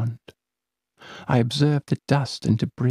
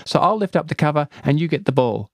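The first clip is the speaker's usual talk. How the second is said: put-on light, quick girlish voice; young girl; 5 semitones higher, 3 dB louder, much faster than usual. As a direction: play the eager friend, quick and higher. Girl companion voiced in story.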